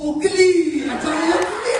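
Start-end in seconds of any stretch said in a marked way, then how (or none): 0.1-0.5 a short, higher shout; shouted guess. Acted. Guessing the misheard name at the friend, short shout. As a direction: call the wrong name out with full force.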